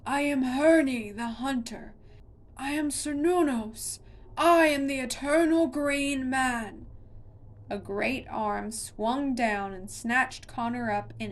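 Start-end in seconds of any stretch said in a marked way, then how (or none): 0.1-6.9 loud and higher, proclaiming each name; monster roar. A narrator's reading. Monster declares its names, roaring at Connor. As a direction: boom it out like a god. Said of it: "roared the monster".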